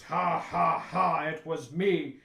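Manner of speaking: loud, slow, deliberate villain laugh and reveal; hammy villain's spoken "ha ha ha"; villainous stage vampire. Acted. Vampire steps out to reveal himself as culprit, mock villain gloating. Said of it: "intense looking vampire dressed person walks out and goes".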